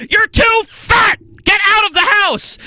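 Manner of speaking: high, loud mock scream. A commentator mocking a health PSA, screaming. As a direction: yell it like a bullying PSA. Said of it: "being screamed at".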